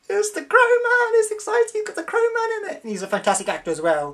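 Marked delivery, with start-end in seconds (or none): 0.1-2.8 very high squeaky excitement; squeaky fan voice. Retold or imitated. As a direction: squeal like an overexcited kid. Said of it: "I'm like".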